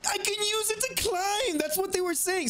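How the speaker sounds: very high delighted realization; excited discovery. Real, not acted. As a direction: exclaim it like a discovery. Discovers climbing trick.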